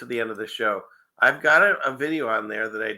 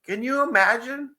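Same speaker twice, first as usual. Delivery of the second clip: laughing high and bright; giggling while asking; 14 semitones higher than usual. Real, not acted. Laughing at crab video.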